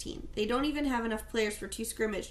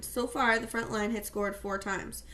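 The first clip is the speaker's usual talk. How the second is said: ordinary delivery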